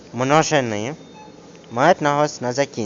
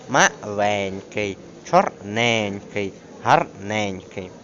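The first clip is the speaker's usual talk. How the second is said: МАЛЕНЬКИЙ, ЧОРНЕНЬКИЙ, ГАРНЕНЬКИЙ slowly, beat on the ЕНЬ syllable; stressed suffix; 2 semitones lower, much slower than usual. Shown as a demonstration. Teacher demonstrating adjective rule, stressed suffix. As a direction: stretch the ENK suffix in each word. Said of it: "завжди є наголошенням".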